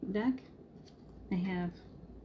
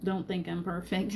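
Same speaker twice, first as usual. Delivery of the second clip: ordinary talk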